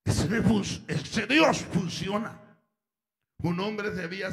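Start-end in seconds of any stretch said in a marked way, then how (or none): 0.0-2.6 loud, impassioned pulpit preaching; preaching at full force. Acted. Preacher calling sinners to God, loud impassioned preaching. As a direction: preach it out, driving each line home. Preacher urging listeners to Christ.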